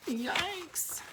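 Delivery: high, small squeak of alarm; mock fright. Reader showing children the web picture, mock fright. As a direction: squeak it softly, pretending fright.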